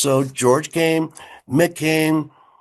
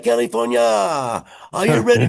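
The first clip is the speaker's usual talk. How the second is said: rock announcer; 8 semitones higher than usual. Retold or imitated. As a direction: call it out to the stadium. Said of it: "I always make a joke".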